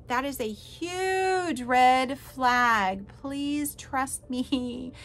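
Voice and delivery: huge drawn out very long, red flag word by word; emphatic warning. Real, not acted. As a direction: stretch "huge" and plead slowly.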